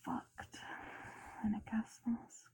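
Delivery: soft unvoiced whisper. Real, not acted. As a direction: breathe the words intimately. ASMR whisper to listener.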